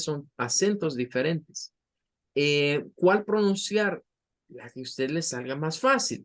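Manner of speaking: ordinary talk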